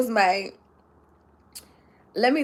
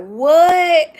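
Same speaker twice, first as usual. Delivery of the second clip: loud, higher drawn-out what; amazed friend; 5 semitones higher, 6 dB louder than usual. Retold or imitated. Friend hearing her astonishing news, amazed. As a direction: act out his astonishment. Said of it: "It blew his socks off".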